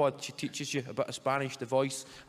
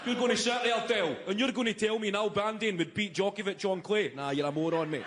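indignant, raised-pitch pub debater; mock pub argument; 6 semitones higher than usual. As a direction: argue like an outraged tennis expert. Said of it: "guys like that sitting arguing".

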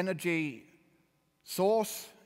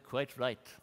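a hint of a prim, stuffy judge, slightly lower and softer; custard-faced judge; 4 semitones lower, 4 dB quieter than usual. Retelling the judge's ruling to the audience, mild stuffy judge voice. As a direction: lightly voice a smug English judge. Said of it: "in that custard-faced voice that they all have".

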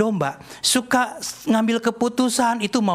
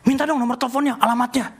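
higher-pitched, enthusiastic asking; eager request; 6 semitones higher than usual. Retold or imitated. Man asking for the healer's contact details, eager. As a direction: ask eagerly, as if retelling your own excitement. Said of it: "saya langsung semangat".

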